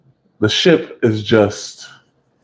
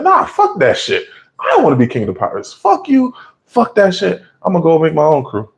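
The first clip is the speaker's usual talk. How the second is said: Buggy's indignant refusal; 7 semitones higher than usual. Retold or imitated. Buggy refusing Shanks, furious.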